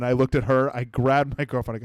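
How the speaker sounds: usual speaking voice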